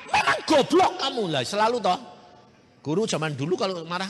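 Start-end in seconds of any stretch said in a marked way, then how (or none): 0.1-1.2 loud, high scolding of the pupil; angry teacher scolding. Retold or imitated. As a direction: scold like a furious old-school teacher. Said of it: "Duh, gurunya marah".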